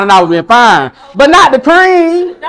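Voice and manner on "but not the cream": high, slow, laughing outcry over the cream; mock outrage. Real, not acted. Telling the audience about the ruined cream pants, high laughing outcry. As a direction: wail it like the cream was sacred.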